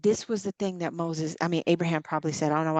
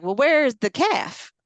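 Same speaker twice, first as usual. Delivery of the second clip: high, loud, drawn-out question; puzzled questioning; 5 semitones higher, 7 dB louder than usual. Acted. Followers asking Abraham about the sacrifice, puzzled questioning. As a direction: ask it slowly, openly baffled.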